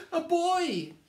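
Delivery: high, loud alarmed yell. Acted. Bear catches sight of Eddie, yelling. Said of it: "yelled the bear".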